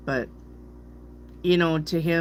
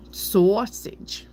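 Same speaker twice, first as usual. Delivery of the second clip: slow Brooklyn "saw-sage"; Brooklyn accent; 5 semitones higher than usual. Shown as a demonstration. Reader showing the other way to say it, Brooklyn accent. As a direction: stretch sausage into a Brooklyn "sawww-sij". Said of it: "sounds like you're from Brooklyn".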